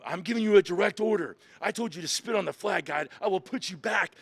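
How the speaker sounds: stern drill instructor. Retold or imitated. Drill instructor threatens the recruit, forceful order.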